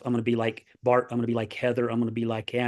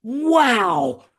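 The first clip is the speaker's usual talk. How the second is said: long, high, loud wow; mimicked wide-eyed amazement; imitating amazed grandson; 10 semitones higher, 6 dB louder than usual. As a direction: stretch out a child's awestruck wow. Acting out amazed grandson's reaction.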